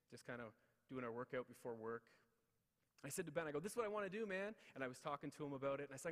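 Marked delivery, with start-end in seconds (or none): 3.6-4.5 higher and louder eager pitch to his best friend; excited pitch. Retold or imitated. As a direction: sell the big idea to Ben.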